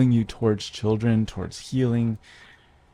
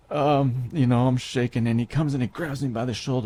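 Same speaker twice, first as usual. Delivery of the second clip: ordinary talk, nothing marked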